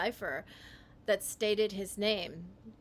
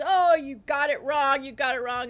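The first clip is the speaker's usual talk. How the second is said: loud, higher imitation of naysayers; raised-voice mimicry of critics; mock dismissal; 4 semitones higher, 10 dB louder than usual. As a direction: talk over him as the scoffing crowd. Voicing everyone dismissing the solver.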